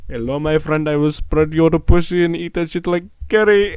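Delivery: high, loud exaggerated Indian accent; mock Indian accent. Vlogger mocks a creepy Indian commenter, loud high Indian accent. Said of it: "some fucking old Indian guy that's like".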